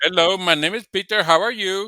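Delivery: put-on amateur Indian accent on a phone greeting; attempted Indian accent. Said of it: "trying to do an Indian accent".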